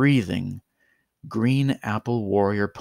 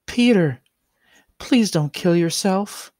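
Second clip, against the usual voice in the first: put-on high, pleading pony girl voice; young magical girl voice begging him to stop; 8 semitones higher, 4 dB louder than usual. A narrator's reading. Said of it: "came a voice from behind him".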